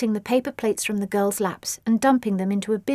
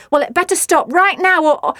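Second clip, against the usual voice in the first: higher, louder faltering threat; stammering; 8 semitones higher, 7 dB louder than usual. A narrator's reading. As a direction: trip over the threat. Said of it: "stammered Nellie".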